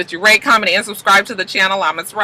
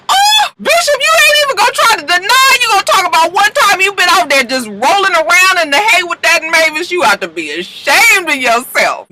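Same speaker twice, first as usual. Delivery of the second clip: much higher, louder, hammed-up scold; mock scolding; 12 semitones higher, 4 dB louder than usual. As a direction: wag a finger at Bishop, squealing. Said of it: "I said".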